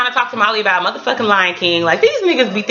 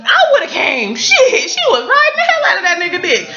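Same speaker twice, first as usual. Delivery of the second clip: cracking up while she tells it; laughing hard; 10 semitones higher than usual. Real, not acted. Laughing to her viewers about Lawrence, pitched high.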